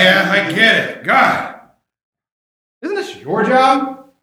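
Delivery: ordinary talk, nothing marked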